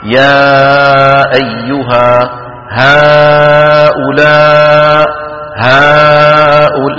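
يا أيها and هؤلاء chanted slowly with a medium mouth opening; the correct way, jaw open just midway; moderate opening. Shown as a demonstration. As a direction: model recitation with moderate opening. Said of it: "فتحا وسطا".

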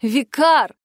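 loud call of the name; shout. A narrator's reading. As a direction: call out to him sharply through a door. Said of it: "крикнул мастер Вайс". (громкий окрик)

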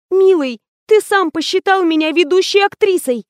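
high, sweet protest; wide-eyed innocence. A narrator's reading. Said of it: "захлопала глазами Лена".

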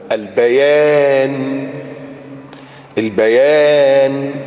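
very drawn-out word, held level; slow, reverent recitation; Quran recitation. Acted. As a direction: stretch the long vowel slowly. Recites a verse.

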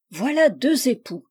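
plain reading voice

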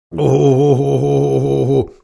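long moaning howl. Acted. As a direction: moan in unison. The beasts moan in dismay.

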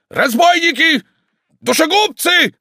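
very high, loud shouts across a yard; yelling abuse. Acted. Tarantyev storms across the yard, yelling abuse. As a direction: hurl each word at full voice. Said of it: "кричал он, идучи по двору".

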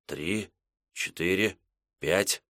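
soft, hushed counting; tense whispered countdown. Acted. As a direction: count under your breath. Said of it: "шёпотом считал Мекша".

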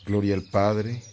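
solemn liturgical recitation; reciting a prayer. Acted. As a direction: pray it aloud like a priest leading the faithful.

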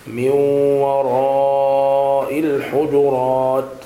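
وراء chanted slowly with a long held "raa"; connected madd. Shown as a demonstration. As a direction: intone وراء الحجرات, stretching the madd evenly. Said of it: "المد المتصل".

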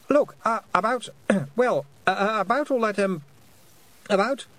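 high, halting, flustered start; nervous boy. A narrator's reading. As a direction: stumble over each attempt to begin. Nervous boy stammering an apology.

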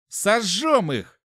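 high, slow, drawn-out exclamation; zealous cry. A narrator's reading. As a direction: proclaim it with cruel eagerness. Guard eagerly decides to burn them.

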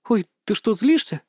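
high-pitched, startled question; lifted, wondering tone; surprised. A narrator's reading. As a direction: ask in sudden surprise. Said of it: "удивилась она".

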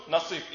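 نصيف as naseef, long ee; fourth variant. Shown as a demonstration. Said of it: "أو تقول".

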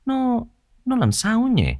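high, soft, puzzled character voice; puzzled musing. A narrator's reading. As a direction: wonder aloud, half to yourself. Nhân vật ngơ ngác tự hỏi. (giọng nhân vật ngơ ngác thắc mắc)

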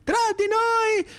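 high, slow, drawn-out orator's cry; Mussolini oration. Retold or imitated. Mussolini addressing the crowd, high slow oratory. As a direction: proclaim it from the balcony. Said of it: "c'è Mussolini".

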